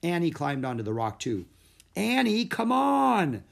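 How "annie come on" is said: high, loud call, end drawn out; urgent yell across the water; impatient shout. A narrator's reading. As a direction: shout for her to follow. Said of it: "shouted Jack".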